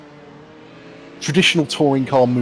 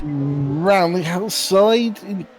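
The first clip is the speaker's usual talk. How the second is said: higher, drawn-out commentary on a daring move; excited race call; 6 semitones higher, slower than usual. Real, not acted. Commentator calling a risky move around the outside, excited. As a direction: call the pass with rising excitement, stretching the words.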